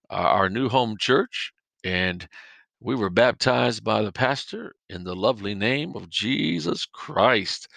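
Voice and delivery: ordinary delivery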